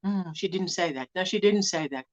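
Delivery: low, soft mock grumble; quietly mimicked objection; imitated muttered denial. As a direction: mutter it under your breath in imitation. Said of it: "She's going like".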